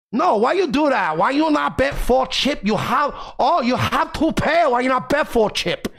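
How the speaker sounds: shrill imitation of a Chinese card player; mock Chinese accent. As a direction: nag in a broken-English Chinese accent. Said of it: "old Chinese women that fucking yell at me".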